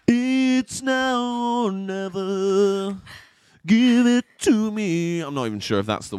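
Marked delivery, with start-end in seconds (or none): 0.1-3.0 high, slow crooning [name]; [name] impression. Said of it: "this [name] impression". 3.7-5.2 slow, loud [name] croon; [name] impression. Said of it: "this [name] impression".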